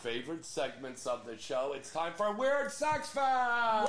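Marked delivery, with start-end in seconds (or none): 1.8-3.9 slow, loud, big announcement; announcer shout. Acted. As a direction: boom out the segment title. Announcing segment title.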